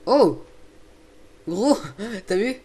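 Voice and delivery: loud, high exclamation of surprise; amazed outburst. Real, not acted. Gamer to his friend after a huge jump, amazed. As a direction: burst out amazed, with a laugh after.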